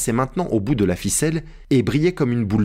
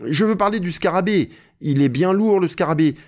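high, lightly voiced grumble about the load; Jupiter, complaining servant; 8 semitones higher than usual. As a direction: explain plaintively, pitched up. Narrator voices Jupiter explaining. (Jupiter, plainte légère et aiguë)

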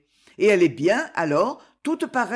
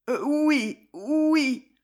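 high, halting yeses; stammering; 7 semitones higher, much slower than usual. A narrator's reading. Daughter questioned about the young man, stammering high. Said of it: "bégait Gisèle".

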